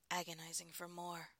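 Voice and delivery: usual speaking voice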